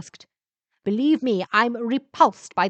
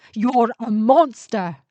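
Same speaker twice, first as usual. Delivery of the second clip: protesting; 3 semitones higher, 7 dB louder, slower than usual. A narrator's reading. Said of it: "Lindsay protested".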